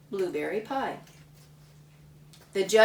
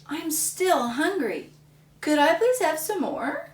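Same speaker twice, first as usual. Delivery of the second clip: put-on pleading little boy; 7 semitones higher, 4 dB louder than usual. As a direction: ask sweetly for more, still hungry. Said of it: "Then Samuel said".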